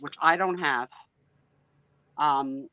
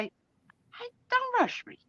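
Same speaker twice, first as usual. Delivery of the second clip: mock indignant; 4 dB quieter, much slower than usual. Real, not acted. Teased to hurry up.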